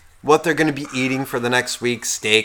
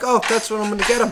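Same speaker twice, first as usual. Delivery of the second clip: mock wife; 7 semitones higher, much faster than usual. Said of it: "fucking going like".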